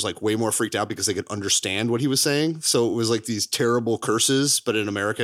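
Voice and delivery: ordinary talk, nothing marked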